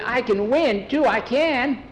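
put-on high, pleading boy's voice; eager insisting. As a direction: insist with pitch way up. Skeeter insists he can win.

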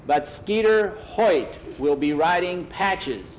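slow, high, drawn-out announcement; stretched-out racetrack call; megaphone announcer. Acted. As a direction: project the names across the grandstand. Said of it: "they heard on the megaphone".

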